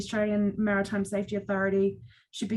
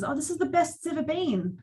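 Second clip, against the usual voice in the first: mocked phrase; 6 semitones higher than usual. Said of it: "my most hated phrase".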